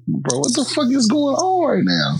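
acted-out high-pitched bewilderment; bewildered disbelief. Man reacting to a stranger in his car, bewildered high voice. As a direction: voice leaps up high, mock panic.

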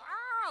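piercingly high, loud imitated shriek; mock child's scream. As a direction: scream like a toddler at the top of her lungs. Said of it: "she's just screaming".

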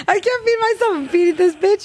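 high-pitched, cracking up in disbelief; laughing outrage. Real, not acted. Host griping about feeding a killer with taxes, laughing outrage. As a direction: protest through real laughter.

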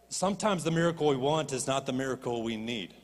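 usual speaking voice

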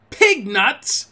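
high, loud cry of outrage; incredulous, furious yell; scream. A narrator's reading. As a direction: shriek it back in disbelief. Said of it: "repeated Mary in a scream".

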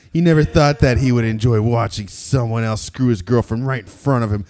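ordinary talk

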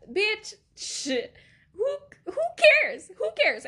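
high, exasperated outburst; indignant, high-pitched protest. Real, not acted. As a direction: push it up high in disbelief. Exasperated at classmates' hushed gossip.

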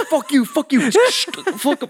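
high squeaky curses fading out with a laugh; fading radio curse. Pretend. Pretend CB curse fading out of range, high and laughing. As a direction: let it trail off like a signal dying. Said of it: "until it faded away".

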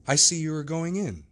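Utterson's voice. Acted. Lawyer addresses Hyde.

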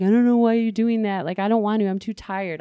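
mock protest, easing off at the end; protesting excuses. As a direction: push back like you're dodging exercise. Voicing her own refusals to daughter.

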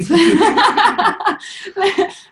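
big high bright laugh; real burst of laughter. Real, not acted. Laughing at the midwife's too-late reply. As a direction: laugh out loud at the memory.

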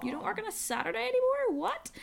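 high, squeaky mock disbelief; incredulous. Retelling her friend's Saturday off, high incredulous squeak. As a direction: act stunned that she's off work.

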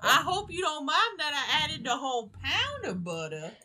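hammed-up southern cook laughing; mock Paula Deen. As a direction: gush it like a butter-mad cook. Continuing the Paula Deen bit.

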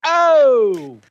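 loud high surprised gasp. Real, not acted. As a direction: react in shock. Reaction to the beer jab.

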